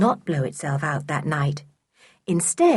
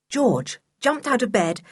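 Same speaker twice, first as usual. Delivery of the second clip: plain reading voice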